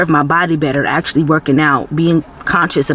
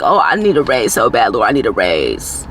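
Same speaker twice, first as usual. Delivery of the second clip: much lower-pitched imitation of pleading; mock pleading; 8 semitones lower than usual. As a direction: drop the voice low to mimic someone desperate for more. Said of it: "because you thinking like".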